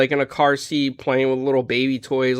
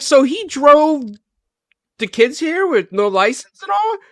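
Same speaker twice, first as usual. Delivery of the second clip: higher, slow, disbelieving question; retelling, asks the woman in mock disbelief; incredulous; 12 semitones higher, 3 dB louder than usual. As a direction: stretch it out in disbelief.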